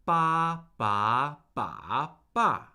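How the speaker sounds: "ba" level, rising, dipping, then falling; four Mandarin tones. Shown as a demonstration. Teacher recapping the warm-up, "ba" in four contrasting tones. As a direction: run "ba" through all four tones in order. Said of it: "the four tones of Mandarin Chinese".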